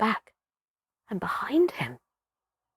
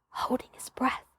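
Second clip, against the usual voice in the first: breathless whisper; 12 dB quieter than usual. A narrator's reading. Narrator whispering as Tumei watches the clearing, breathless whisper. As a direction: whisper it, far softer, as if holding breath.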